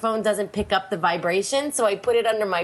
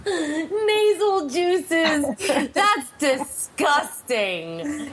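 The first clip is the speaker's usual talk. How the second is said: amused disgust; 7 semitones higher, much slower than usual. Real, not acted. Amused disgust at co-host's phrase.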